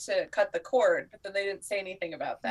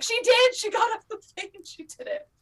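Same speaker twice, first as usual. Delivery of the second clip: much higher, louder gushing; excited; 14 semitones higher, 4 dB louder than usual. Retold or imitated. Fan texting friends about the finale, much higher and louder. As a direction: squeal the news out in joy. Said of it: "I'll text people and be like".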